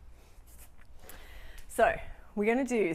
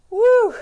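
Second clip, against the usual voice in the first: high, loud victory whoop; excited woo; 12 semitones higher, 15 dB louder than usual. Real, not acted. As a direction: whoop for finishing. Cheer at finishing workout.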